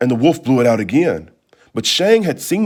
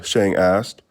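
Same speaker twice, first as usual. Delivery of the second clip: usual speaking voice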